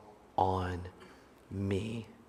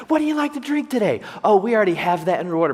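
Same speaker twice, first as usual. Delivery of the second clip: much higher, fast chirpy barista; peppy barista; 8 semitones higher, 4 dB louder, faster than usual. Retold or imitated. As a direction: mimic the overly bubbly barista.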